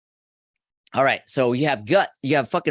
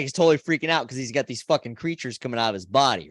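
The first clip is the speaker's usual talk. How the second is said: normal talking voice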